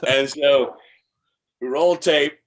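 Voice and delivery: ordinary talk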